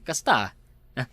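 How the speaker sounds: ordinary talk, nothing marked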